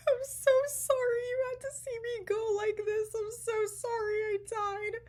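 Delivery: high, loud, wailing sob-talk; bawling. Retold or imitated. Apologizing to Ben after fainting, high wailing sobs. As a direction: wail the apology through tears, hammed up. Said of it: "start bawling my eyes out".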